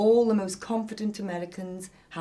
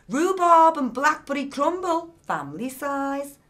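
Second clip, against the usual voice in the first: louder, much higher, announcing the label; reading out loud; 8 semitones higher, 9 dB louder than usual. A narrator's reading. Nicky reading Ollie's bin wrappers aloud, loud and high. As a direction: call out the label like an accusation. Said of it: "Nicky read out loud".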